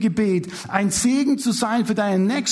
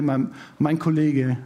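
normal talking voice

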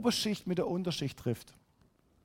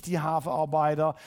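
ordinary talk

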